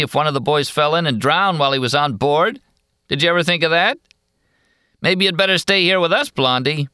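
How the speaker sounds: high, leering heckler voice; mock her with fake concern; taunting loafer. A narrator's reading. Loafer taunts girl about waiver.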